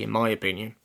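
plain reading voice

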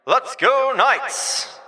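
high, drawn-out team cheer. Real, not acted. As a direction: cheer the Knights on.

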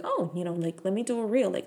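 ordinary talk, nothing marked

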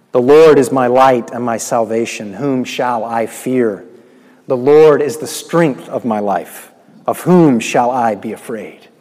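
loud proclaimed psalm; preaching at full force. Acted. Preacher proclaiming the psalm to the congregation, loud. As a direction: proclaim each line loud and strong.

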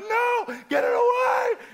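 high, loud frantic pleading; panicked plea. Retold or imitated. Boy pushing away mom's vinegar, high frantic yell. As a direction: squeal it as the vinegar stings. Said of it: "And I'm going".

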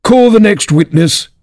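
high, relieved royal call; great relief. A narrator's reading. King moves the trial on, calling out in relief. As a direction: call out, glad to move on. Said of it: "with an air of great relief".

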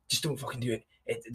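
quiet voice of doubt, barely there; hushed inner voice. Retold or imitated. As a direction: whisper the temptation to quit. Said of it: "one side of my brain was going".

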